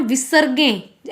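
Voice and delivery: ordinary delivery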